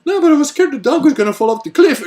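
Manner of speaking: put-on high, loud childish protest; whiny kid's excuse. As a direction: protest like a scared little boy. Brother's excuse to shouting father.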